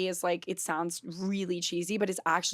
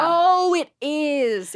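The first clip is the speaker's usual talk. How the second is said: excited; 8 semitones higher, 8 dB louder, much slower than usual. Real, not acted. Excited agreement.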